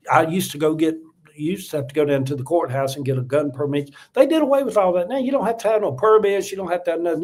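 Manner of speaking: ordinary talk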